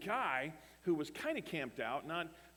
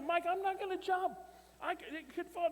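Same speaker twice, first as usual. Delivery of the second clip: alarmed refusal. Retold or imitated. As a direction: refuse in a high, comic yelp of alarm. Pastor refuses to jump off ladder.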